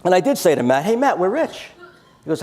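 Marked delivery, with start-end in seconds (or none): 0.8-1.7 excited. Retold or imitated. Excited quoted exclaim.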